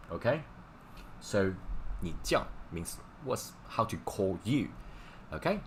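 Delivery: ordinary talk, nothing marked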